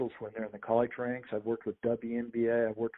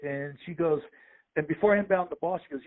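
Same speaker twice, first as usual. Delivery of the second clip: ordinary talk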